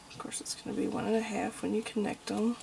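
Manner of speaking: soft whisper to herself; whispered aside. Real, not acted. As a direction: murmur it as a side note while working. Aside while drawing.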